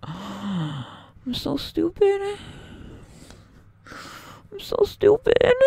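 drawn-out, rising wail; anguished lament. Real, not acted. Gamer wailing slowly after losing the run.

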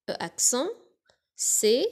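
usual speaking voice

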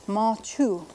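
"ma chu" with an aspirated, breathy ch; "ma chu", stress on chu; Ponca word. Shown as a demonstration. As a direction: puff air after the ch of "ma chu". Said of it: "which means bear".

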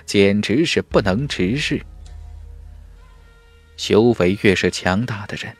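ordinary delivery, nothing marked

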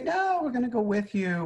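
put-on high, pleading cry of the young widows; pleading daughters-in-law.

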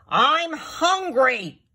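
high, loud, drawn-out complaint; blurted outburst. A narrator's reading. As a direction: blurt it out, stretching the words. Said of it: "blurted Lion".

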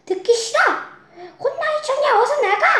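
high, loud angry outburst; angry shout. Acted. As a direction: shout them out of the house. 꿀꿀이는 성이 버럭냈어요.

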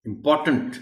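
इंपॉर्टेंट with a crisp retroflex tant; इंपॉर्टेंट with a clear tant ending; clear t sounds. Shown as a demonstration. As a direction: land hard on the tant in इंपॉर्टेंट. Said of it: "टंट की आवाज है".

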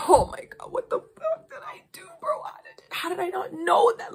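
very high, breathy panicked whisper; panicked inner whisper. Retold or imitated. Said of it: "you're like".

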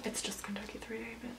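very soft, quick grumble; muttered aside. Real, not acted. Rider correcting the event's new name, muttered aside. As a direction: mutter it far under your breath.

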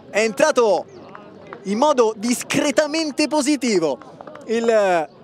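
excited play-by-play, shouting the goals; breathless italian goal commentary. Acted. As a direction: call the match live, building to yells. Live match commentary.

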